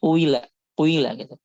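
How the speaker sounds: kuila with a brief u before i; ishmam demo. Shown as a demonstration. Teacher showing ishmam on qila. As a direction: start kuila with a short dhammah gliding to kasrah. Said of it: "memulainya dengan harokat dhammah".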